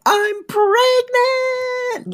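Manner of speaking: high, very slow, drawn-out sitcom reveal; the cheesy way to deliver it; hammed-up announcement. Shown as a demonstration. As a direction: overplay it as a big predictable beat. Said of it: "you coulda gone for the".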